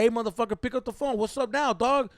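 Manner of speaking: loud, high, rapid-fire confrontation; angry yelling. Retold or imitated. Boyfriend confronts the man on the phone, yelling. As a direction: act out chewing out a rival over the phone. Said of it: "I was, like, yelling at him".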